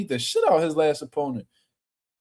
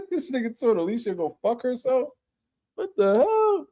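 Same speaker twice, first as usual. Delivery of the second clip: incredulous; 8 semitones higher than usual. Real, not acted. Reacting to the clip.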